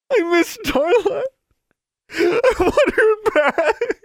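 very high, loud grieving wail, slowing; anguished wail. Acted. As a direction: wail it out in grief. Mary sinks to knees grieving.

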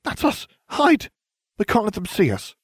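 very high, urgent alarmed cries; alarmed gasp. Acted. Hermione spots their past selves and urges hiding, high and urgent. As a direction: hiss the warning in sudden panic. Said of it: "Hermione gasped".

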